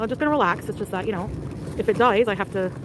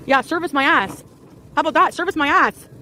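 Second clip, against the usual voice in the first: really pissed; 8 semitones higher, 7 dB louder than usual. Real, not acted. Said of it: "Sorry, I'm really pissed".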